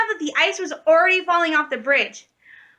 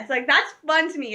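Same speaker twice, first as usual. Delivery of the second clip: normal talking voice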